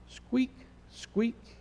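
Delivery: high, soft little mouse squeaks. Acted. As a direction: squeak like a tiny lost mouse. Lost baby mouse squeaking.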